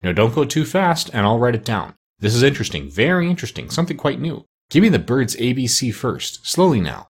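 ordinary delivery

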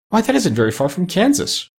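high, eager exclamation; surprised cry. A narrator's reading. Dorothy hears Oz was born in Omaha, exclaiming. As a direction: pitch it well up, crying out in surprise. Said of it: "cried Dorothy".

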